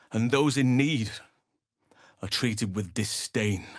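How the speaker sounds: ordinary talk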